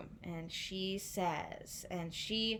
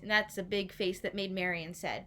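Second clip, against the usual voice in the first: ordinary delivery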